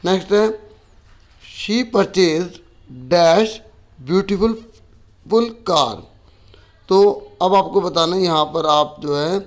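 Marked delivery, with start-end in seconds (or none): 1.5-5.9 dictation read. Shown as a demonstration. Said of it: "teacher reads exercise sentence".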